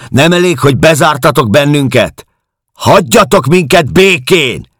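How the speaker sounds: very high, loud outraged cry; shouting. A narrator's reading. Locked-up woman crying out at her tormentors, shouting. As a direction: shout it at full pitch, demanding to be left alone. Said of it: "kiáltotta".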